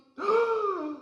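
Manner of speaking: high excited gasp. Pretend. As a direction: gasp in delight. Excited discovery.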